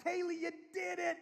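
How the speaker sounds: high, loud cheer; acted over-the-top parental enthusiasm; excited dad. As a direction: whoop with pride to urge the child on.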